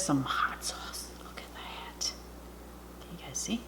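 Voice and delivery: hushed whisper between bites. Real, not acted. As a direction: whisper it softly. Whispering while eating.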